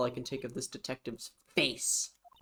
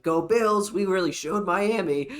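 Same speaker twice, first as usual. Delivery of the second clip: imitating O J; 5 semitones higher, 6 dB louder than usual. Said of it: "He's like".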